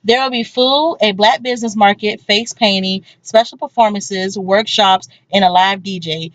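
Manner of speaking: low, measured announcement voice; ad read. Pretend. Recorded event ad read.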